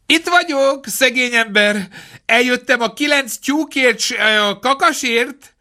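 a sly fox calling at the door. A narrator's reading. Fox at the door, eager for his hens, high sing-song.

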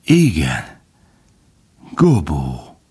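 hushed, whispery with emotion; trembling, deeply moved. A narrator's reading. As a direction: breathe the name out in wonder. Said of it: "A hangja reszketett, izgatott volt".

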